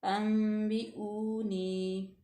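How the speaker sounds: slow अंबिऊनी with a hidden nasal n; ikhfa nasal. Shown as a demonstration. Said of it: "थोड़ा सा गुना पैदा करेंगे".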